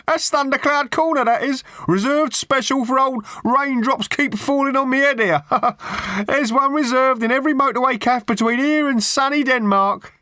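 very high-pitched, cheery passer-by voice; cheery stranger. A narrator's reading. Stranger in overalls warning Arthur off the seat, cheery. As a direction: pipe up as a jolly bloke passing by. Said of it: "said a passing stranger in overalls to Arthur cheerily".

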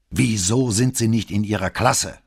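brusque commander questioning a subordinate. A narrator's reading. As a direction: challenge the cadet gruffly. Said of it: "fragte Bembo barsch".

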